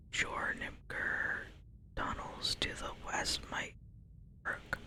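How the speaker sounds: softly whispering the quest text, lightly voicing the quest giver; whispered quest reading. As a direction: read the quest aloud in a hushed whisper. Reads quest giver's lines.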